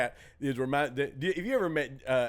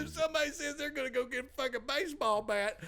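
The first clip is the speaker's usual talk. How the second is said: high emphatic voice; 12 semitones higher than usual. Retold or imitated. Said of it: "he goes".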